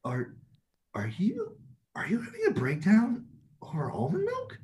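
hushed, incredulous question; half-whispered disbelief; whisper-breathed are you having a breakdown. Retold or imitated. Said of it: "I'm like".

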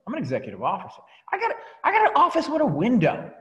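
high, loud, puffed-up boast; mock self-importance. Mocking his own past ego to the team, pompous. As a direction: brag like a big shot with a corner office. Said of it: "You see how silly that is".

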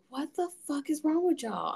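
high-pitched disbelief at praise; incredulous retort. Retold or imitated. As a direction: question their sanity, pitched well up. Retells disbelief at being praised.

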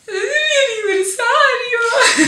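high wailing mock sob with a laugh; mock crying. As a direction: whine it like a heartbroken kid. Retold own lament, crying laugh.

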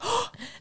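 high, soft exaggerated gasp; mock gasp. Feigning shock that mom is a doctor, gasping.

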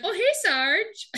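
high, drawn-out mock sweetness; fake-casual greeting. [name] greeting the drill sergeant mid sit-ups, fake casual.

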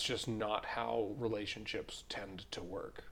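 unvoiced whisper. Real, not acted. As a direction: drop to a whisper. Trailing off softly.

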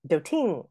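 "Doting" as do-TING; second-syllable stress on "Doting"; misplaced stress. Shown as a demonstration. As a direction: push the stress onto the end of "Doting". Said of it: "We don't say that".

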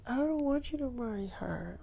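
put-on high, soft pleading girlfriend voice; Tia's voice. [name] begging David not to marry her, high and soft.

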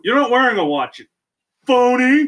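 mock accusation. Mock accusation among friends.

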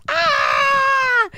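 long, high, loud "aah" scream; imitated group scream. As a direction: let out a sustained high yell. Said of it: "Incluso gritábamos".

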